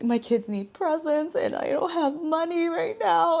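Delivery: a high, teary, half-crying replay of her plea; retold tearful plea. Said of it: "I was just, like, so emotional".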